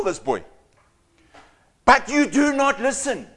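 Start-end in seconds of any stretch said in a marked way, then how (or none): none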